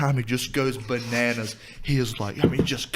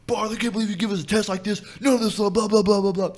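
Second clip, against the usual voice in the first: mock angry rant; 7 semitones higher, faster than usual. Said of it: "just starts, like, cussing at him".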